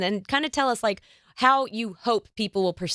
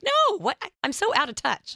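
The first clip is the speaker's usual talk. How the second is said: mock surprise; 11 semitones higher than usual. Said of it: "I'm like".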